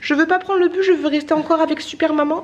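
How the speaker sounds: put-on high, pleading child voice; cute little girl. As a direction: imitate a small child protesting. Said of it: "sa petite voix aiguë et bien mignonne".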